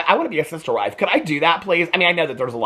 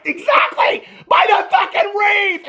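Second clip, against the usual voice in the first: much higher, loud slow yell; exasperated shout; 3 dB louder, slower than usual. Real, not acted. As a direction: yell at the screen to buy it. Vlogger cheering Mary on.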